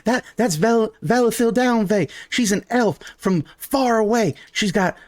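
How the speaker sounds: high-pitched excitable daughter voice; Calliope. Acted. Daughter introducing the elf to mama, high and eager. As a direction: butt in eagerly, pitched well up. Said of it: "Calliope interrupts and goes".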